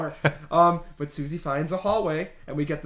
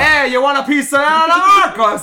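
much higher, much louder crone voice; old Italian crone; 7 semitones higher, 10 dB louder than usual. Retold or imitated. The old witch daring Susie to kill her, loud crone voice. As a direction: brag like a taunting old witch. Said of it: "this Italian prostitute was like".

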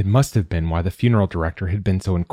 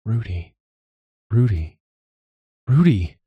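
building call; 3 semitones higher than usual. A narrator's reading. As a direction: call out a name with growing urgency. Calling a name, rising each time.

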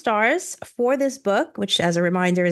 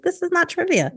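exasperated; 8 semitones higher than usual. Retold or imitated. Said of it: "I was like".

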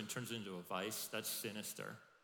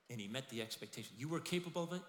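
usual speaking voice

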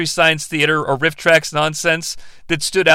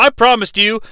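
normal talking voice

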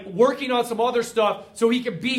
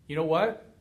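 normal talking voice

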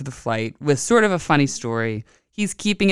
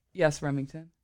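plain reading voice

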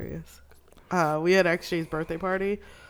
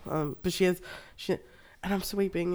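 normal talking voice

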